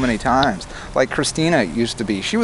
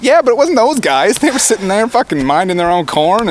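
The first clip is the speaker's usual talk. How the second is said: higher, louder protest, acting out a debate; retold indignant comeback; 8 semitones higher, 5 dB louder than usual. Man rebutting a girlfriend's point about the men, indignant. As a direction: jump in to rebut, re-enacting the argument.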